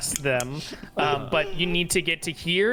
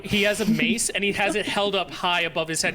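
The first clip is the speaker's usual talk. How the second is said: usual speaking voice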